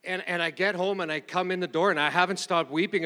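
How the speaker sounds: ordinary delivery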